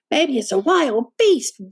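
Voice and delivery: slow, lightly voiced little cub; a frightened wee bear, squeaked out slowly; squealing little bear cub. A narrator's reading. Little cub fearing a wild beast, mild squeal. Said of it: "Little Wee Bear squealed".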